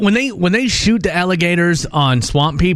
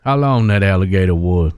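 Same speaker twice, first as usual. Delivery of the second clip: put-on Cajun accent; 8 semitones lower than usual. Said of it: "stopping the accent".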